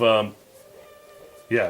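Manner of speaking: ordinary talk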